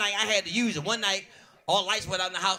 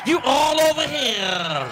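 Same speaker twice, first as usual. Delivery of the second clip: loud, slow holler; slow called-out line; 6 semitones higher, 7 dB louder, much slower than usual. Retold or imitated. Comic calling out to Sharice, slow loud holler. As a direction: drag it out like a call across the room.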